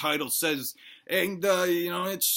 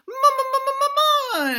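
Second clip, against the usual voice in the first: very high, loud shriek for mama; terrified scream; 7 dB louder than usual. Acted. Baby dinosaur wakes screaming for mama, terrified. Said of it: "he screams".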